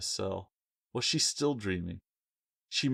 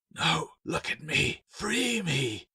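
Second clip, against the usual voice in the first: higher, slow insistent creature voice; urgent statue; 8 semitones higher, slower than usual. A narrator's reading. Statue urging Claire to free it, insistent. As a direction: press her to look and obey. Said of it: "the statue urged".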